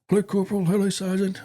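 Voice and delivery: lightly imitated, high-pitched soldier's greeting; meek detained soldier. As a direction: greet superiors in a put-on high voice. Retold greeting from the locked-up soldier.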